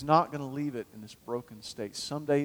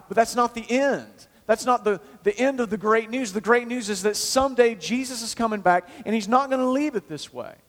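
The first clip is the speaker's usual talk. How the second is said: higher and louder, driving the point home; full-force preaching; 8 semitones higher, 5 dB louder than usual. Acted. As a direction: preach it out to the congregation.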